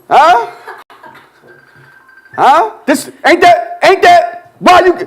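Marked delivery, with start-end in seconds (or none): none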